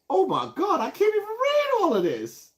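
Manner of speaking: high squealing overwhelmed exclaim, pitch way up; overwhelmed by the comments, voice jumping high; excited. Real, not acted. Swamped by flood of comments.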